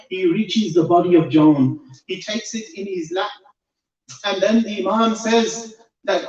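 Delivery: preacher lifting the congregation toward the martyr's death; impassioned preaching. Acted. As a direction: build the story to an emotional peak. Climax of the sermon story.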